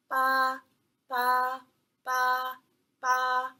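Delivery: BA repeated on a high, level pitch; each BA held straight and high, like one flat line; first tone. Shown as a demonstration. Teacher drilling first tone for learners, BA high and level. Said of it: "a little prolonged high pitch".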